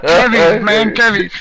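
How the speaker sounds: put-on Chicano accent. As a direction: shout it like a lowrider.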